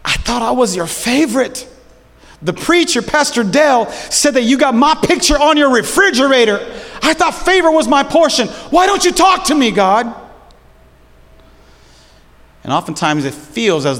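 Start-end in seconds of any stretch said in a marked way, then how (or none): 0.0-10.1 whiny prayer. Pretend. Whiny mock prayer to God.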